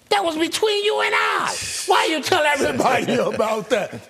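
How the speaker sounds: mock outrage. The receiver protesting to Madden after the game, mock shouting. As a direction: yell at a friend who spilled your secret. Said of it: "I said, John".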